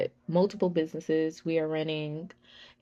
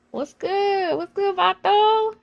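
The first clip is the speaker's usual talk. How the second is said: high, loud homie greeting; street greeting; 13 semitones higher, 5 dB louder, slower than usual. Retold or imitated. Greeting Mexican friends in Long Beach, loud and high. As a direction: call out to your friends.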